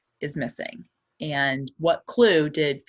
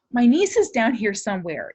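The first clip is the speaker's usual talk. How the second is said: urgent plea; 7 semitones higher, 7 dB louder than usual. Acted. Aunt pleads at the gate.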